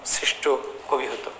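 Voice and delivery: hushed whisper. Acted. Dramatic hushed climax of point. (ফিসফিস করে নাটকীয়ভাবে)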